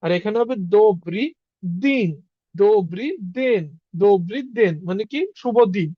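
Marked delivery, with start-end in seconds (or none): 0.0-4.7 slow "দোবরি দিন" with weight on "দিন"; teacher's careful "দোবরি দিন"; pronunciation demo. Shown as a demonstration. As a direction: spell out "দোবরি দিন" slowly. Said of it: "উচ্চারণ".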